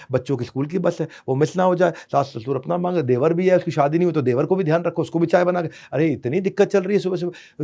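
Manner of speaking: normal talking voice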